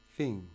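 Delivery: "Finger." stressed FIN-ger, loud; first-syllable stress. Shown as a demonstration. Teacher showing stress from Roald Dahl words, FIN-ger stressed first. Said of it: "l'accent est sur la première syllabe".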